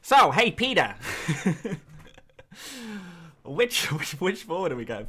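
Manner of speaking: Family Guy Lois calling Peter; Lois Griffin. Retold or imitated. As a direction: nag Peter in a shrill cartoon mom voice. Lois impression again.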